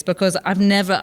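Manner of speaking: usual speaking voice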